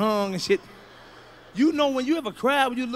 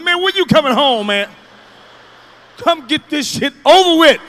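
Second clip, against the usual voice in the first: louder, higher pleading; desperate kid; 4 semitones higher, 8 dB louder than usual. Retold or imitated. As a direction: urge dad to hurry home. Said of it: "I said".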